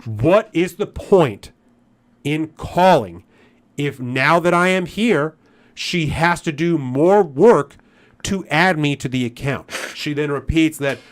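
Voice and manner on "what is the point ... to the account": louder and slow, every word spaced out; irritated, pointed question. Retold or imitated. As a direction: drag it out, fed up. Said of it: "I'm starting to get an attitude".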